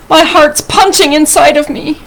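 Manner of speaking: animated read-aloud, lifting with the drama; story reading to children. A narrator's reading. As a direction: read a picture book expressively to children. Reads a picture book aloud.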